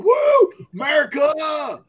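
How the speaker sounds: slow, high, shouted whoop; hammed-up patriotic yell, stretch it out. Teasing friend about Trump shirt in Poland, mock rowdy shout. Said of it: "you're Larry the Cable Guy".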